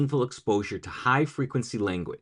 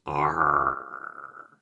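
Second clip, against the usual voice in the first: low pirate arr; pirate growl; 11 semitones lower, 4 dB quieter than usual. Shown as a demonstration. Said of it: "Say it in a pirate voice".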